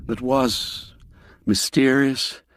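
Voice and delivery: ordinary delivery, nothing marked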